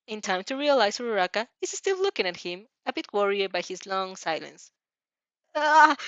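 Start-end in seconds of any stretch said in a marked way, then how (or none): 5.6-6.0 flustered yelp; startled stammer. Acted. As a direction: caught off guard, embarrassed. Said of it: "he stammers, flushing brightly".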